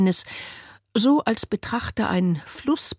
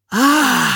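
loud, high contented ahh after tea; high loud sigh of relief; satisfied sigh; 6 semitones higher, 7 dB louder than usual. A narrator's reading. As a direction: sigh out with pleasure. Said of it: "seufzte sie".

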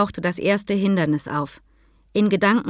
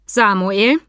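louder, incredulous question with a rising end; stunned; 5 dB louder than usual. A narrator's reading. Maria turns to Sam beside Mika, stunned. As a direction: say the name in disbelief. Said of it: "Maria schaute ihn fassungslos an".

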